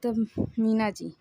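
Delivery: plain reading voice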